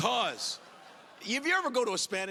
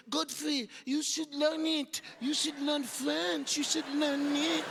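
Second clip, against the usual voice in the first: slow, higher coaxing voice; French girlfriend; 7 semitones higher, slower than usual. Retold or imitated. French girlfriend urging him to learn, slow and higher. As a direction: coax him slowly, sing-song.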